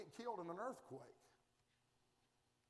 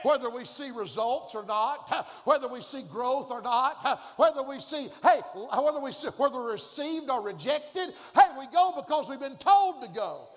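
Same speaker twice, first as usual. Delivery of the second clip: louder and louder preaching; rising preaching; 4 semitones higher, 12 dB louder than usual. Acted. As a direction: build to a shout from the pulpit. Preaching at full force.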